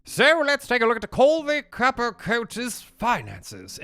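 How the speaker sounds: high, slow mock-British announcement; hammed-up fake British voice; bad British accent. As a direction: put on a terrible British accent again for show. Repeats the bad British accent bit.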